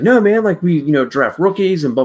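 high breezy imitation; imitating buddy. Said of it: "He's like".

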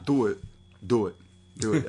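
Ben Stiller. Retold or imitated. Host recalling a Ben Stiller film, understated mock goading. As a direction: lightly imitate a comic actor's catchphrase. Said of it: "what's the Ben Stiller?".

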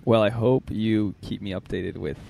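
ordinary delivery

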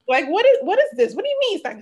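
sharp high outrage; what-do-you-mean disbelief; indignant disbelief. Retold or imitated. Retold irritated reaction.

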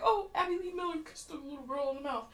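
high, quick mock voice; mimicking oblivious editors. Mimicking editors who okayed the scene, mocking. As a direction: rattle it off as a mock quote.

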